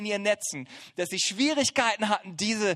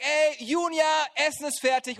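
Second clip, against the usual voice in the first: put-on calling kids to dinner; 6 semitones higher than usual. Shown as a demonstration. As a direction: friendly call through the house. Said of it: "rufe ich meine Kinder und sage".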